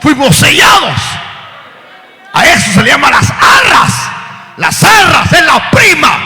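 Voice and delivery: loud, high preacher's shout; preaching at full force. Acted. Preacher warning the church, full-force preaching. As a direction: build to a cry. Sermon climax.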